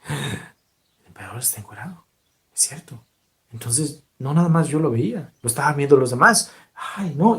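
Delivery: whispered murmur among the crowd; hushed, secretive whisper. Acted. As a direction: people whisper to each other about the emperor. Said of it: "empezó a murmurar".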